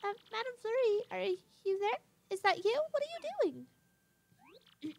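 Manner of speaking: timid little character. Acted. Little Punio asking after Madam Flurry, high questioning voice. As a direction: call out high and unsure, stretching the last question.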